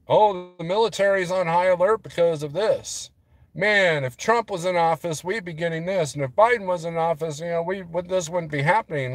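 mock pundit rant, pitched high; griping pundit impression. News pundit griping to viewers about the military alert, mock-agitated.

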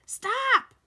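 loud, high cry to halt; alarmed shouted interruption; urgent shout. A narrator's reading. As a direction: cut in with a sudden high yell. Jack cuts off Annie's wish.

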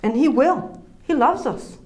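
normal talking voice

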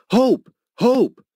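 frantic searching shout; desperate shout. Retold or imitated. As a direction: yell her name as loud as you can. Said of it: "just as loudly as I could".

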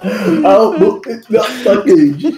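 slow-motion parody of a broken stream; mocking a laggy voice. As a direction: stretch it like a lagging connection. Echoing laggy friend's question slowly.

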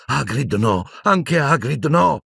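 high, drawn-out desperate plea; anguished inner cry. A narrator's reading. Harry's inner voice as Hagrid is attacked, anguished. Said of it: "una voce nella testa gli ripeteva".

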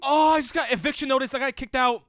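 excited outburst. Real, not acted. Kicked out in game.

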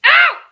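sharp cry of pain, high and loud; pained yelp. Real, not acted. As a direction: yelp like you just got hurt. Hit in the eye by laser.

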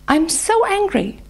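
sudden high angry cry; voicing anger. Shown as a demonstration. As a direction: throw it up high in frustration. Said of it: "So I will continue with anger".

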